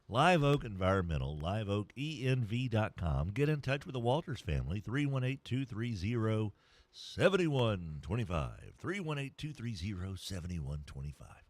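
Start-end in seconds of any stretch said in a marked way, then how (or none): none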